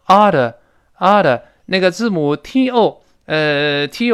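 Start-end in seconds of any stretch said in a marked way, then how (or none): 0.1-1.4 "oughta" as AH-duh, stressed first syllable; punched AH, light flapped "duh" in "oughta"; linked weak form. Shown as a demonstration. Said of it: "连读变成oughta".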